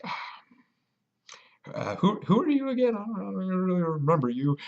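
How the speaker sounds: high, innocent put-on voice; feigned innocence. Dodging the sword's owner, high and falsely innocent. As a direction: play dumb in a high sweet voice. Pretending not to know owner.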